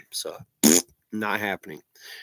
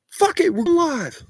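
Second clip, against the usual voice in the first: high, slow triumphant cry; excited; 5 dB louder, slower than usual. Real, not acted. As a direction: announce it with glee.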